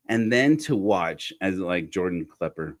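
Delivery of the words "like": ordinary delivery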